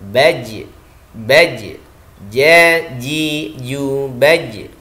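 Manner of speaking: "ja, ji, ju, baj" spaced out, breath held; jim drill. Shown as a demonstration. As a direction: drill each syllable, bouncing the j of "baj". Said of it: "nafas tertahan". ("ja, ji, ju, baj" tanpa embusan nafas)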